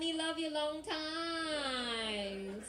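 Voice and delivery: high, sing-song mock Chinese accent; southern China accent. Comic tries charming the cop, high drawn-out southern China accent. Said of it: "in my southern China accent".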